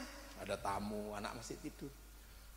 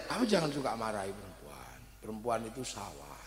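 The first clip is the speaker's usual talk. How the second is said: a hint of an elder giving advice, lower; calm, measured lecturing, slightly lower; lightly voiced teacher's counsel; 9 semitones lower, 5 dB quieter than usual. Lightly voiced teacher advising the young husband, calm. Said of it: "dibilang saya".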